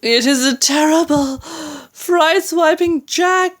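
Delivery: high, wailing cry of dread; high-pitched panic; terrified giant. A narrator's reading. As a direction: wail it as a giant caught in a nightmare, slowing on the last words. Giant raving in terror in nightmare.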